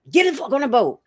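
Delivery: exasperated command, retold; imitated mad outburst. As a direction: act out somebody fed up, pitch jumping up. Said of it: "maybe somebody got mad".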